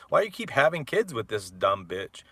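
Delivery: ordinary delivery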